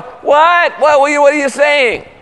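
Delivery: high-pitched, stammering mock bafflement; incredulous. Father to kids who talk like a prayer, high baffled protest. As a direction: blurt it in comic disbelief.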